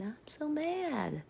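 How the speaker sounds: high, slow, squeaky pretend-mad whine; a hammed-up little-kid tantrum; mock mad voice. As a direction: ham up being mad, cartoonishly. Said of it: "I made a podcast saying".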